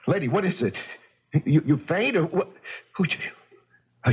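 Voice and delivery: usual speaking voice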